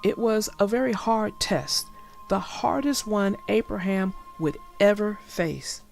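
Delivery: ordinary delivery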